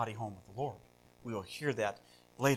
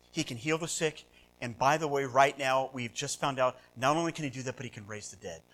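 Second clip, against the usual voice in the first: ordinary delivery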